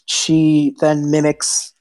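normal talking voice

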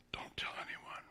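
hushed whisper, no voice; breathy hush of a warning; whispered secret. Retold or imitated. Jesus's hushed command retold.

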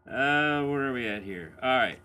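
ordinary talk

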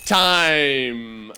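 drawn-out call of time; long, held announcer's call. Real, not acted. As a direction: call time like a quiz host, stretching the word. Host calls end of timed round.

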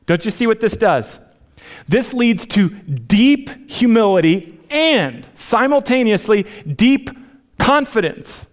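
high, loud, impassioned preaching; preaching at full force. Real, not acted. Pastor preaching to the church at full force. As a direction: lift them up to a ringing climax.

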